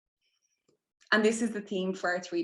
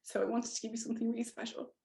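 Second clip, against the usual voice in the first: fast, whispered through emotion; tearful whisper; 3 semitones higher, 8 dB quieter, faster than usual. Real, not acted. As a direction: whisper it, fighting tears. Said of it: "I'm gonna get emotional".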